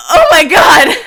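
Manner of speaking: loud happy shriek; excited cry. Real, not acted. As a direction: squeal with joy. Player overwhelmed by reunion.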